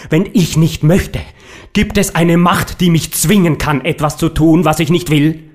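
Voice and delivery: loud, high, defiant outburst; angry defiance. Acted. The painter confronts his secretary in a loud, defiant outburst. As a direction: shout your refusal in his face. Painter defies Nifaluzi up close.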